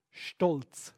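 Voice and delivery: normal talking voice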